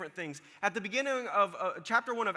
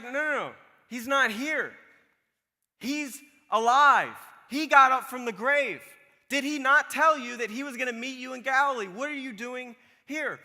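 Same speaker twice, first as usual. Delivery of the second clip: higher, louder, the angel insisting he is risen; excited angel; 5 semitones higher, 5 dB louder than usual. Retold or imitated. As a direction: announce it like thrilling news. Angel tells women he is risen.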